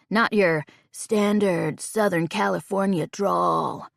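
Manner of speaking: slow, lazy Southern California surfer drawl; stoned surfer drawl. Acted. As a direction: drag it out like a surfer after a bong hit. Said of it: "He pronounces that last part like some stereotypical surfer".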